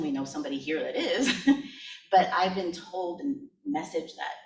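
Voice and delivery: ordinary talk, nothing marked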